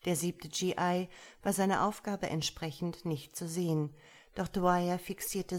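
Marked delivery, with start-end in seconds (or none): none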